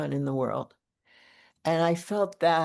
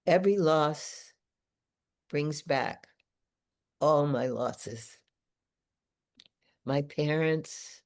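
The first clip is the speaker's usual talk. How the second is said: poem reading; 3 semitones lower than usual. Acted. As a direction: let each short line of the poem stand alone. Reads own poem aloud.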